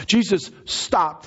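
slow, high-pitched proclamation; emphatic preaching. Real, not acted. Preacher repeating the turning point, high emphatic proclamation.

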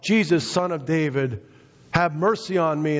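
high-pitched mock cry for mercy; retold shouting out. Blind man calling to passing Jesus, retold shout. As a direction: call out high, without full volume. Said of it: "this man now is shouting out".

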